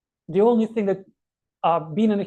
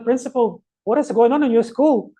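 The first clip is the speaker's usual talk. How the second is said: higher, louder mock scolding; yelling inspector; 4 semitones higher, 6 dB louder than usual. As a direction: berate the principal in acted outrage. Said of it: "He started yelling at the principal".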